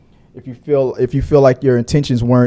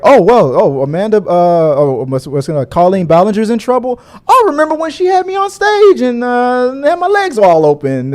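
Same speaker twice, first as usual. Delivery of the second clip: mock fan voice; 7 semitones higher than usual. Fan voiced mockingly recalling stage.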